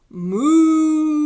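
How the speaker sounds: big held moo; moaning cow. Pretend. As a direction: belt out a drawn-out cow moo. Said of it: "Cow moaned".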